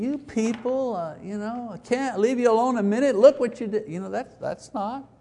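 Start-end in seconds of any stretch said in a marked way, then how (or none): 0.0-3.9 high, fast fed-up scolding; mock exasperated father. Preacher mocking an impatient father-God, high and fed-up. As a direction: sigh out the blame. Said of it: "it's not God the Father who's saying".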